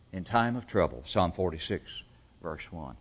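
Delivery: ordinary talk, nothing marked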